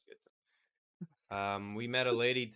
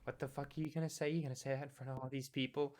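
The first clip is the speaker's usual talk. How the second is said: put-on battered girlfriend; 4 semitones higher, 3 dB quieter, faster than usual. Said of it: "You play the battered girlfriend".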